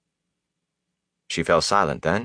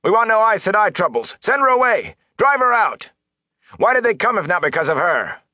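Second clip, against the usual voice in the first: high-pitched angry villagers' shouts; strained high shout, acted; shouting from crowd; 13 semitones higher, 3 dB louder than usual. A narrator's reading. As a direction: call out over a crowd in protest. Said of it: "shouted then".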